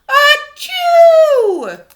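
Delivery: loud high sneeze; big sneeze. Acted. Elephant Gerald sneezing at Piggy, huge sneeze. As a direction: let the sneeze explode.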